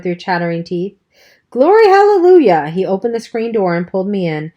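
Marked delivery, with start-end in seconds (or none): 1.5-2.7 much higher, loud alarmed outcry; shocked exclamation. Acted. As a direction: explode in dismayed surprise. Dally shocked boy is wet.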